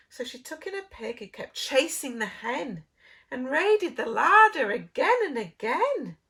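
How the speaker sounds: plain reading voice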